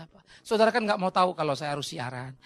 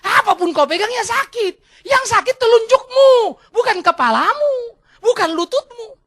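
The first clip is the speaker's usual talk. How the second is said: loud, high, emphatic scolding; acted doctor losing patience, building louder; exasperated doctor; 12 semitones higher, 10 dB louder than usual. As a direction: shout the diagnosis at a stubborn patient. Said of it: "dokternya bilang".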